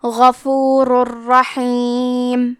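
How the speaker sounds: غفور الرحیم very slow, tanween merged into a doubled ر; idgham without ghunnah. Shown as a demonstration. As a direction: recite غفور الرحیم merging into ر. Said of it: "بغیر غنہ سے لیکن تشدید کے ساتھ".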